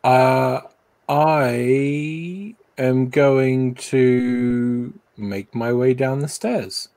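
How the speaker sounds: ordinary talk, nothing marked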